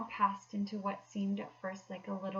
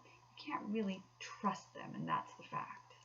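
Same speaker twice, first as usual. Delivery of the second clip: whisper; 7 dB quieter than usual. Acted. Rat confides danger of weasels.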